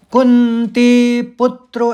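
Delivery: कुंती पुत्रो slow, पुत then त्रो; stressed syllables. Shown as a demonstration. Teacher demonstrates the second quarter's stresses. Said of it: "तौ का एक आघात आ जाएगा।".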